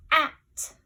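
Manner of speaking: "at" called out loud, short a stretched clear; word said out loud. Shown as a demonstration. Teacher announcing the test word to young kids. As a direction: present the word "at" for the kids to hear. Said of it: "Your first word is".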